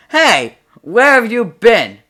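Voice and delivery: slow, high and loud cop; a cop calling out slowly. Acted. Cop to the old man, slow loud call. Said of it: "The cop gets out of the car and says".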